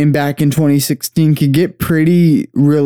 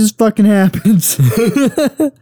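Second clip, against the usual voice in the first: laughing; 7 semitones higher than usual. Real, not acted. Laughing while finishing friend's joke.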